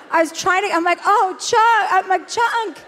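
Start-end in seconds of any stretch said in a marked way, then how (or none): 1.1-1.9 high, loud call to the dog. Retold or imitated. Calling her drugged dog. 2.3-2.8 high call breaking into a laugh. Retold or imitated. Calling her drugged dog again.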